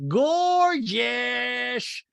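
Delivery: high, squealed compliment in imitation; Rinna. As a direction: mimic an over-the-top admirer. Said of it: "Rinna goes".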